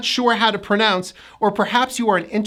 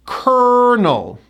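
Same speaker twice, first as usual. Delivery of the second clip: "Kernel" with a high, held KER, falling on -nel; first-syllable stress; 4 semitones higher than usual. Shown as a demonstration. Teacher models the word, stressed and higher on KER in "Kernel". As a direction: hit KER in "Kernel", let -nel drop. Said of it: "First syllable gets the stress".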